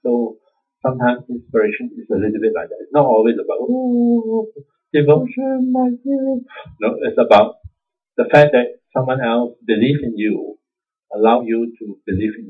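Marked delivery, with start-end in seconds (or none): 3.6-6.4 high, swooning mock voice, much higher; mock devotion. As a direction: gush it like a sugary devotee. Mocking a syrupy devotional cliche.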